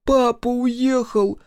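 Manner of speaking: put-on very high, slow wailing child; through tears. A narrator's reading. Микки tells sister about father, through tears. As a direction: squeeze it out tearfully, drawn out. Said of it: "сквозь слёзы выдавил Микки".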